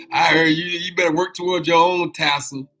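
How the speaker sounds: high, loud playful admonishment; loud teasing. Real, not acted. Host playfully scolding listeners about tassels, loud and teasing. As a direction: rib them at full voice.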